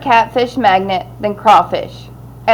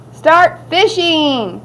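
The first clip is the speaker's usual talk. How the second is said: higher, drawn-out announcement; calling out; 6 semitones higher, much slower than usual. Acted. Scoutmaster starting the scouts' fishing contest, calling out. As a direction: call it out slowly to the group.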